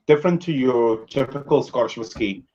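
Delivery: normal talking voice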